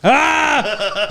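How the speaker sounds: excited shout. Real, not acted. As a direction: burst out with a yell. Reacting loudly in card game.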